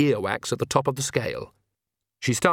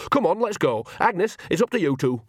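urgent; 7 semitones higher than usual. Acted. Nanny hurries the witches away.

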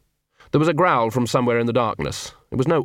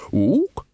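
questioning ook; 6 semitones higher than usual. Acted. Librarian ape speaks.

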